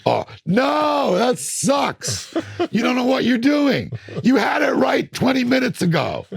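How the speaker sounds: high, loud shout at his own mistake; scolding himself. Retold or imitated. Producer alone mixing, berating himself in a high, loud shout. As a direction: yell at yourself in frustration. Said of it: "That's me mixing".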